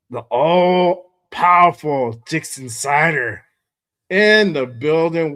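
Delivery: loud, slow, high announcer call; big stretched-out hype introduction; grand announcer roll call. Real, not acted. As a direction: announce them like a champion entering.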